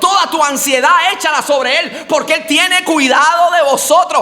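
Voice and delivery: high, strong preaching voice; fervent preaching. Acted. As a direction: proclaim it over the church. Preaching at full force.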